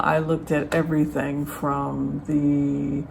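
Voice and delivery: ordinary talk, nothing marked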